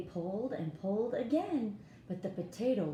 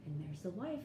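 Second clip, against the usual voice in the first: ordinary delivery, nothing marked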